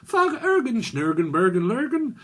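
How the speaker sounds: high-pitched mock Swedish gibberish. Psychic imitating someone from Sweden, high mock Swedish accent. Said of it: "I'm from Sweden".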